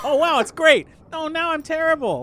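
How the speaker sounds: mocking whine. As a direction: whine it back at him to tease.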